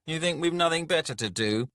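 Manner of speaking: high, loud indignant outburst; indignant dwarf lord. Acted. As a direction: snap at a time-waster from above. Annoyed lord snaps at Skalg.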